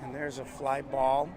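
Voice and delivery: hushed golf announcer voice. Acted. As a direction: keep it soft like at the golf. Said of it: "I'm gonna go to my golf announcer voice".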